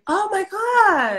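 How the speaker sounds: high, drawn-out gasp of dismay; shocked sympathy. Real, not acted. Host hears of cousin's flooded salon, shocked. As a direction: stretch it out, aghast at the news.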